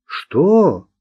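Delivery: high, startled question; surprised. A narrator's reading. Mark hearing he must take the man in, surprised. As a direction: shoot the pitch up in disbelief. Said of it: "удивился Марк".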